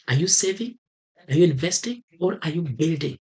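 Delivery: ordinary delivery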